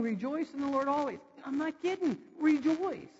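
high, emphatic command; foot-stomping insistence. Acted. Preacher voicing Paul repeating his command, insistent and emphatic. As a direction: stamp it out like you mean it. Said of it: "He's stomping his foot down".

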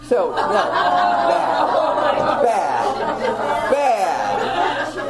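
laughing at her own bad behaviour, scolding herself; self-scolding laugh. Real, not acted. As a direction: scold yourself through real laughter. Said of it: "I confess all my sins to you".

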